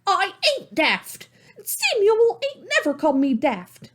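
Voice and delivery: high, hurt, indignant protest; incensed girl. Acted. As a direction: insist she's not daft. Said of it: "Salome was incensed".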